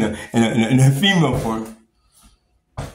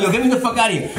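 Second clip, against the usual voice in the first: higher-pitched mock angry demand; retold pissed off; 6 semitones higher, faster than usual. Pissed-off patient demanding release, mock agitated. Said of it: "you're like, fucking pissed off".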